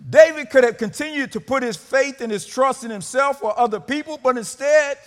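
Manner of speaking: high, very loud, driving sermon cry; full-force preaching. Acted. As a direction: proclaim it at the top of your voice.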